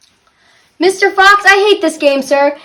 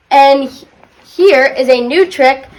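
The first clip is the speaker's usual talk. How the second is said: usual speaking voice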